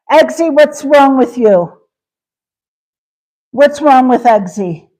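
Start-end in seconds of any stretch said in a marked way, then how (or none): none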